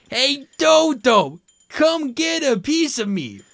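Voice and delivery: squeaky high taunt. Acted. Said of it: "provoked the latter".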